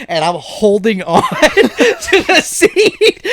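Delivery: high, loud laughing panic; breathless laughing retelling; laughing while telling. Real, not acted. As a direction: laugh through the memory of clinging on. Clinging to moving car.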